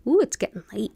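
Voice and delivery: ordinary talk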